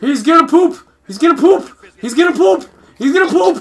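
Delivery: high, loud frantic yell. Pretend. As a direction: scream it in panic. Repeated frantic yelling.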